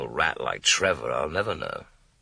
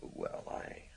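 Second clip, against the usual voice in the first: slow, softer, confiding; soft whisper; 10 dB quieter, slower than usual. Acted. Detective to Susan, a slow flirting whisper. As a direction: murmur it close.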